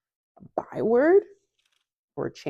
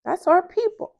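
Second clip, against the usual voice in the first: much higher, louder, mock-proud affirmation; imitating husband; 14 semitones higher, 6 dB louder than usual. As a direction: play him proudly declaring it. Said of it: "And he was like".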